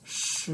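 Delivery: "Shi" gliding upward; second tone, rising. Shown as a demonstration. Teacher showing number ten in second tone, rising pitch. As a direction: draw out "Shi" as the pitch climbs. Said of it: "tone goes up".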